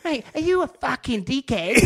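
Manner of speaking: high, loud mock-Aussie outburst; Aussie accent. Aussie customer insulting the barman, put-on Aussie accent. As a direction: imitate an outraged Aussie customer. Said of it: "Aussie accent".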